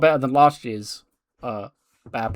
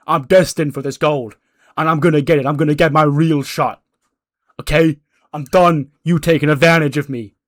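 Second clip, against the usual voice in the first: heel promo rant; 3 semitones higher, 7 dB louder than usual. Retold or imitated. Buster taunting Kofi and Woods after the attack, loud rant. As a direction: gloat over the bodies, building menace.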